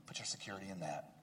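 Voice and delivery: soft whispered close. Acted. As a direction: whisper it like a secret.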